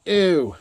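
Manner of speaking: high, drawn-out "ew"; grossed-out squeal; disgusted ew. Real, not acted. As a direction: recoil from something slimy. Handling gross anchovy paste.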